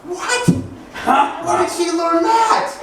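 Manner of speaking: high-pitched, incredulous father; mock shock. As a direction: squawk in baffled disbelief. Shocked father reacting to child.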